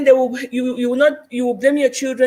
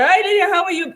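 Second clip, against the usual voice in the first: high-pitched imitated hello to a friend; acted bubbly greeting; cheery phone greeting; 8 semitones higher than usual. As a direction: mimic a friend calling, pitched well up.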